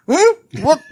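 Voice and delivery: mock choked-up dad. Son imitating his dad caught off guard, high choked squeak. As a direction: squeak it out high and loud like a dad pretending he isn't choking up. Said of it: "getting all choked up".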